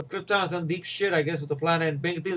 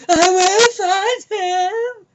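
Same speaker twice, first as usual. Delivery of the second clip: high, slow, much louder yell; over-the-top villain yell; 13 semitones higher, 9 dB louder, much slower than usual. Retold or imitated. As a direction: bellow it like a hammy dark lord. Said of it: "But then he goes over the top".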